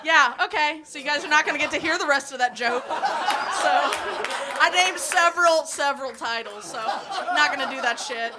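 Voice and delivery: flustered, laughing through it; giggling, embarrassed, talking fast. Real, not acted. Flustered after cutting a joke.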